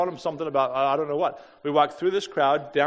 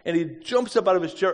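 ordinary talk

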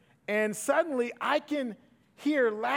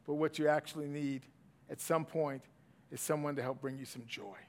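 low and soft, sinking softer to the end; quiet tender mentor; 7 semitones lower, 5 dB quieter than usual. Retold or imitated. Mentor to young pastor after a funeral, soft and tender. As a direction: hand over hard wisdom, hushed.

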